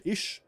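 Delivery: "ich" said as "ish"; country pronunciation. Retold or imitated. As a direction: give "ich" a country sh ending. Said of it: "how us country Germans say it".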